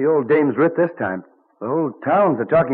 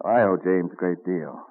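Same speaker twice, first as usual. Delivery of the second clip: ordinary talk, nothing marked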